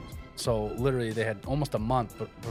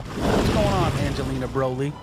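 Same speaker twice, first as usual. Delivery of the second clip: loud excited greeting; 6 dB louder than usual. Acted. Host's jokey Boston-style greeting.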